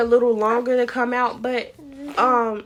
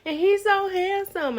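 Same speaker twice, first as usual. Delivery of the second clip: gushing; 11 semitones higher than usual. Retold or imitated. Gushing over baby's pictures.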